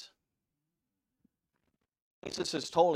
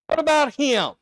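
loud, high, indignant question; selfish Peter; 13 semitones higher, 13 dB louder than usual. Acted. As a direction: blurt it out jealously. Peter asking about another disciple.

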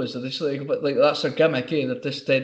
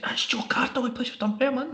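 higher-pitched retold tip-off; imitated insistent colleague; 10 semitones higher, 3 dB quieter than usual. Colleague telling barber who the footballer is, imitated insistence. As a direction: mimic a colleague filling you in, pitched up.

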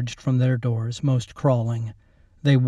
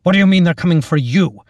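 loud, high, fast demand; urgent shout; 5 semitones higher, 7 dB louder, faster than usual. A narrator's reading. Captain to Lacey over the rising fire, urgent shout. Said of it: "he shouted over the rising fire".

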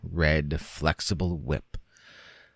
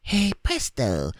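put-on higher, slow child's echo; small boy's echo; 8 semitones higher than usual. A narrator's reading. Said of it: "the clear, small".

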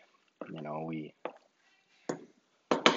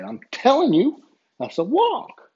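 much higher and louder, standing firm; insisting; 5 dB louder than usual. Retold or imitated. Referee insisting to the coach it's a travel, raised voice. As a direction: leave no room for argument.

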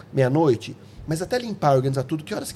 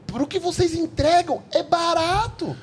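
high, slow, weighing each word; slow emphatic point; 13 semitones higher, slower than usual. Real, not acted. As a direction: stress that it's cheap.

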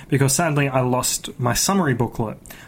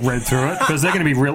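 normal talking voice

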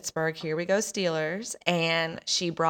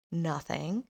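plain reading voice

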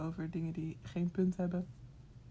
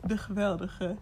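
ordinary talk, nothing marked

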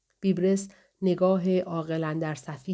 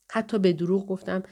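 plain reading voice

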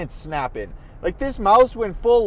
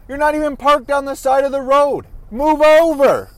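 louder, irritated road rage; angry at driver; 5 semitones higher, 5 dB louder than usual. Real, not acted. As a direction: yell at the driver to move over. Yelling at mail lady driving.

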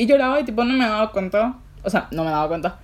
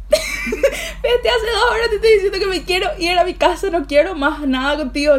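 much higher, cracking between laugh and sob; tearful outburst; 9 semitones higher than usual. Retold or imitated. Telling off her friend while crying, half laughing.

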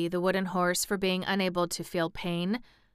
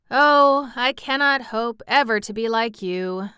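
wistful, raised lament; sighing; 5 semitones higher, 7 dB louder than usual. A narrator's reading. Sawhorse admires the real horse, sighing. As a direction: open on a loud, high "Oh". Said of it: "sighed the sawhorse".

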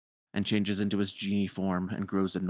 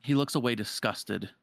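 ordinary talk

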